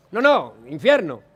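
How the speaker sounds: low, resigned "No, no, infierno"; lightly voiced dead man choosing. Dead man picking hell in the joke, low and resigned. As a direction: drop low and settle it flatly.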